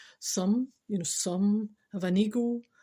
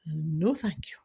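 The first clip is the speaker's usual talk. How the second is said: lower, whispery decline; hushed polite refusal; 4 semitones lower, slower than usual. Acted. Colleague declining invitation into the toilet, hushed and polite. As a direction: decline under your breath. Colleague's awkward reply through door.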